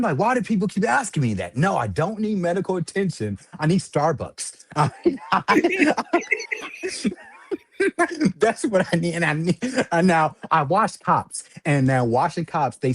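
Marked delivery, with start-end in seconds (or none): 0.2-1.6 higher, exasperated question; fed up with being asked. Retold or imitated. Man answering officers, higher exasperated whine. 5.3-8.3 real high-pitched laughing fit; cracking up, squeaky laugh; high laughter. Real, not acted. Man recalling Starbucks answer, real high laughter. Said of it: "laughing hard".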